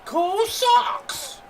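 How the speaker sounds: put-on squeaky Boston accent gag; mock Boston accent. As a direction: tease a Boston accent for laughs. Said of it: "talk in the Boston accent".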